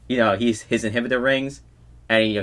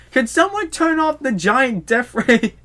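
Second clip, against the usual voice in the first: very high, slow squeaky exasperation; exasperated Sonic; 13 semitones higher, slower than usual. Retold or imitated. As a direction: whine it out in disbelief. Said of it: "And then Sonic's like".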